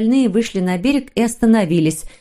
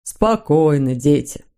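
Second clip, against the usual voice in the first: lower, composed fatherly voice; calming father; 4 semitones lower, slower than usual. Acted. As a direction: settle the children down, pitched low. Father calms quarrelling children. (успокаивающий низкий отцовский голос)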